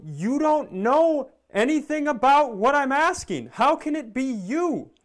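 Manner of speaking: high, loud, incredulous put-on voice; exasperated mock outrage. Acted argument with store employee.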